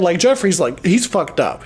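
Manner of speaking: usual speaking voice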